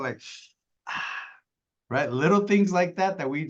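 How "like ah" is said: breathy sigh, then a soft ah; disapproving sigh. Shown as a demonstration. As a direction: let out a judging sigh. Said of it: "be very careful".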